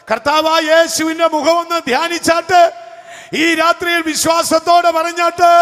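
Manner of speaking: high, loud, shouted call to the faithful; full-force preaching. Acted. Preacher urging believers to raise hands, shouted preaching. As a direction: lift the whole church to a hallelujah.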